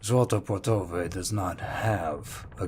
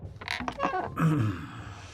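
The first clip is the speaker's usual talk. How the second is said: amused throat clear; 9 semitones higher than usual. Acted. Captain pauses mid-interrogation.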